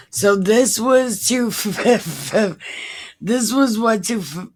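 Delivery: loud reading broken up by laughter; laughing, stumbling. Real, not acted. Reader attempting the next verse, laughing too much to finish. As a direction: keep restarting as laughs cut you off.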